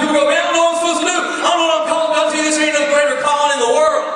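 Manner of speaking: loud, high, fervent preaching; full-force preaching. Acted. Preacher rousing the congregation, loud and high. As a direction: preach it hard to the back row.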